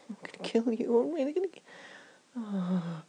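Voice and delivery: ordinary delivery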